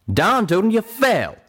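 loud, high exasperated retort; Calamity retorting. A narrator's reading. Friend confirming Little Pip's fall, retorting. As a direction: fire it back forcefully, pitched high. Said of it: "Calamity retorted".

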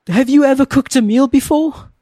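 very high, exasperated challenge; acted outrage climbing high; incredulous. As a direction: question the cook's competence in disbelief. Acted berating of bad cook.

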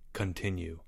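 soft, low single-word order. A narrator's reading. Leo tells Albert to go on, in a low voice. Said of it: "said Leo in a low voice".

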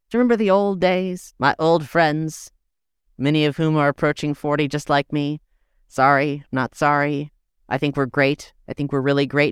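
usual speaking voice

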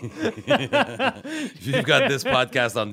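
laughing loudly through a slow joke. Real, not acted. Comic to the hosts, laughing loudly through the line. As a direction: crack up while delivering the punchline. Joking about VHS podcast, laughing.

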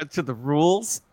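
normal talking voice

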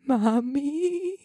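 a dazed man whimpering for his mom; shaky voice. Acted. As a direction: call for mommy like a dazed child. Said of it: "He said in a shaky voice".

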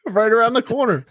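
high, squeaky mock of his own words; squeaky sarcastic echo of a broken promise. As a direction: parrot it back high and teasing. Mocking his own earlier claim.

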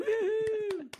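long, high, loud cheer; excited whoop. Real, not acted. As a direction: let out a high celebratory woo. Host cheers after the performance.

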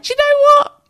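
a sudden thrilled squeal of approval; mock-thrilled about sharing a name with a drag queen; excited exclamation. Excited retold reaction to drag queen.

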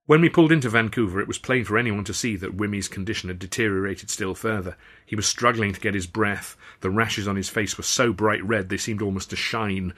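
ordinary delivery

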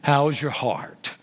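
low, slow deep-voiced old pastor; old pastor friend on the phone, deep slow voice. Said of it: "He's got this deep voice".

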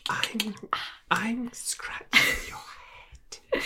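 slow creepy whisper. Acted. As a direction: whisper it like a monster. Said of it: "that's really creepy".

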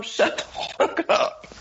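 mock outburst. Ranting at politicians.

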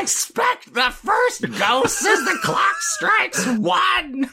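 high, slow, shouted puppet voice; a shrill squawking puppet announcing a ghost, drawn out; Gonzo impression. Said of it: "And Gonzo the Great".

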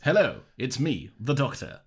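slow, drawn-out mock Doctor voice; grand Doctor intro. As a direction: deliver it like a grand Doctor entrance, slowly. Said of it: "Or sorry".

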